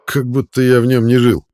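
loud indignant grumble; grumbling. A narrator's reading. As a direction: grumble it out loudly. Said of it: "бурчу я".

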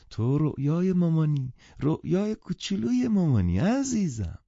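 put-on high, gushing baby talk; doting mother. A narrator's reading. Mother bent over her little daughter, doting. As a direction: coo adoringly at a little one.

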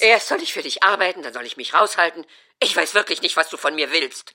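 mother protests she can't win; loud indignant protest. Acted. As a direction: complain at full volume.

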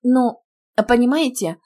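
panicked, hesitant justification; frightened excuse. A narrator's reading. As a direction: start explaining in a fright. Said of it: "с перепугу начала я".

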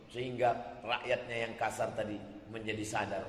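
ordinary talk, nothing marked

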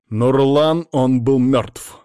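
slow, loud and firm insistence. A narrator's reading. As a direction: stand your ground. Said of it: "твёрдо сказал он".

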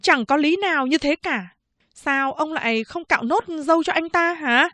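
high, firm female officer; high policewoman's voice. Acted. Policewoman scolds the barber for stopping, high and firm.

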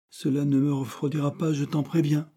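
cheerfully teasing, lightly lifted; gaily. A narrator's reading. Frank to his brother William, speaking gaily. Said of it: "dit Frank gaiement".

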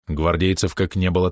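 ordinary delivery, nothing marked

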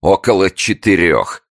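loud high mocking repeat. A narrator's reading. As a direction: jeer it back, slow and loud. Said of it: "насмехалась злая особа".

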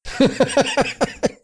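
amused high-pitched laugh; high chuckle. Acted. Chester laughs at Baxter.

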